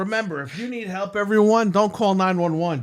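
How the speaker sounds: hammed-up helpline spiel; mock safety announcement. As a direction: deliver fake emergency advice emphatically. Parody advice announcement about Reddit.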